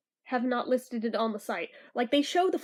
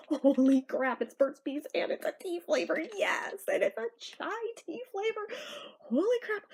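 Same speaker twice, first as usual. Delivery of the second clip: higher, gushing reenacted excitement; excited; 5 semitones higher than usual. Telling viewers how she reacted to the chai flavor, gushing. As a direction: rave like a tea fan who just found it. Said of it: "so I'm like".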